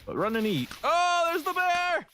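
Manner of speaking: high panicked shriek; terrified horror victim. Real, not acted. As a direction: shriek in sudden fright. Bear appears, panicked.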